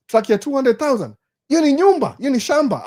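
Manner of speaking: high, loud disbelief at the cost; shocked objection. Acted. Brain objecting to an expensive truck, shocked. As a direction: balk at the price in a rising, incredulous outcry.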